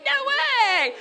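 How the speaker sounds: high, loud squeal of disbelief. Retold or imitated. Wife finding her name matches husband's, squealing in disbelief. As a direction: shriek it like you can't believe it. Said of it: "And I'm like".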